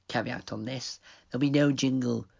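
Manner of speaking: ordinary talk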